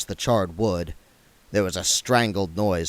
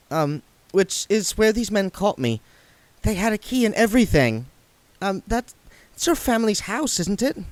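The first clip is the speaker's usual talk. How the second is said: put-on higher, hesitant girl's voice; hesitant young woman; 10 semitones higher than usual. A narrator's reading. Saccharissa nervously tells William about the house, higher. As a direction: falter it out, voice high.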